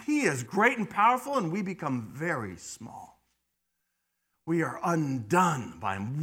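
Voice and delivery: usual speaking voice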